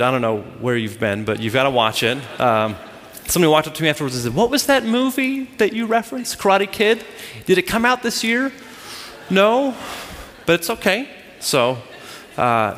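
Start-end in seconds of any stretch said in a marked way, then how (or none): 4.3-8.5 higher-pitched clueless asker; lightly mimicked puzzled listener; puzzled questioner. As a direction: imitate someone innocently asking, pitched up. Clueless listener asking afterwards.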